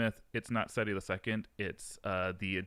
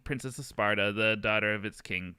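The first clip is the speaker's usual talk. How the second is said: ordinary delivery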